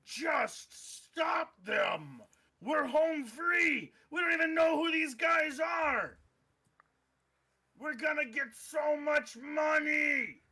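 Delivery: high frantic orc shouting. Acted. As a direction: yell orders in a frenzy.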